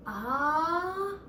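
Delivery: usual speaking voice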